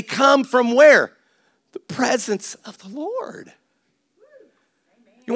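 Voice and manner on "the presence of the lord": soft, slow and drawn out; hushed reverence. Real, not acted. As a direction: drop to a slow, reverent hush. Hushed answer to own question.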